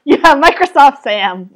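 slow, loud mock text-to-speech voice; Microsoft Sam. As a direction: imitate a computer voice, amused. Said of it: "do a good Microsoft Sam impression".

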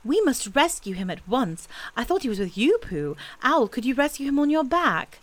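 rally friends to a rescue; earnest boy taking charge; urgent young boy. A narrator's reading. Christopher Robin organising the rescue.

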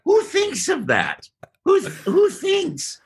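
very high, drawn-out incredulous wail; squealing comic indignation; mock outrage. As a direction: stretch it out in comic disbelief. Mock outrage at dart-shooting clam.

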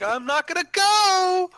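high, slow mocking voice; mock whiny voice. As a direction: whine it in a silly voice. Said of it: "I'm like".

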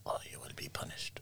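very soft mock-threatening whisper.

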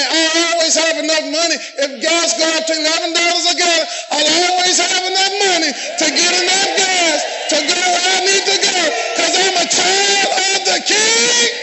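climbing preacher's proclamation; preaching. Acted. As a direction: build it to a shout of faith. Preaching at full force.

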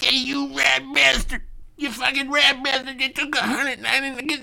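very high, squeaky mock rant; hammed-up tirade in a pinched high voice; Joe Pesci. Angry customer going off about the charge, squeaky mock tirade. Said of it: "going, uh, Joe Pesci on him".

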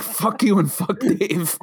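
slow, laughing through the quote. Retold or imitated. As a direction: giggle through the words. Quotes wife while laughing.

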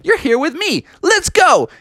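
high, upbeat cartoon dude; cool game hero, high voice; Sonic. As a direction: explain it eagerly, pitched well up. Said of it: "And Sonic was like".

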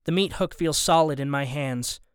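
plain reading voice

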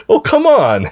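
high startled cry; surprised yelp. Real, not acted. Child punches dad.

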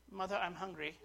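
lightly put-on Cockney, softly spoken; mock Cockney accent. Preacher imagines baby Jesus asking mother for food, mock Cockney accent. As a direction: make it a polite little mock-Cockney request. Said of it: "in his best Cockney accent".

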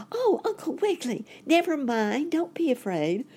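put-on very high, excited child voice; boy crying out. A narrator's reading. Boy rabbit telling uncle not to fear, high excited cry. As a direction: call out high with sudden relief. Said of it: "the boy rabbit cried out".

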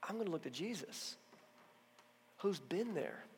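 a new believer resolving softly; hushed resolve. Shown as a demonstration. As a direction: speak it quietly as a vow. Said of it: "believer's hushed vow".

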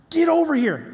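high, insistent imitated command; mock shouted order. Acting out Bill ordering him closer.

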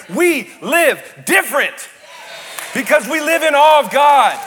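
high, loud, rising sermon shout; fiery revival preaching at full volume; full-force preaching. Acted. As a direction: preach it to the back row, building to a roar.

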